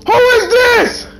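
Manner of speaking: a hammed-up accusing shout; mock outrage shout. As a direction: call out the imposter in front of everyone. Accusing an imposter in game.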